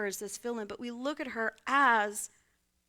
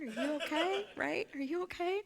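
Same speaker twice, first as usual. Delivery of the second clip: laughing; 5 semitones higher than usual. Retold or imitated. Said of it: "Thomas is like".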